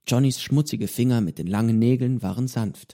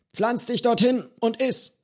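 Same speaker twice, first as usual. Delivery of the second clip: high commanding captain's order; ship's captain tone; 12 semitones higher than usual. A narrator's reading. Johnny ordering the boy to sit and eat like a ship's captain. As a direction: boss him like a captain on deck. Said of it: "in einem Ton, als wäre er der Kapitän eines Schiffs".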